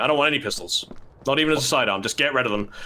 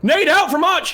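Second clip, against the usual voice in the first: grenade warning yell; 5 dB louder than usual. Real, not acted. Caster calls grenade excitedly.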